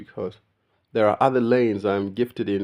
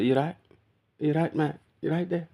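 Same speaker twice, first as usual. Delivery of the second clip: British "You right?" rising higher each time; UK greeting; 6 semitones higher than usual. Retold or imitated. Imitating how people in the UK greet, rising British "You right?". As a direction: ask "You right?" lilting up, British style. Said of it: "in the U K, it's like".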